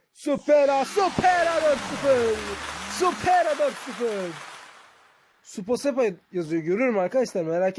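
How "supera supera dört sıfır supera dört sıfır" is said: high, slow, drawn-out goal call; excited commentator. Real, not acted. Fourth goal scored.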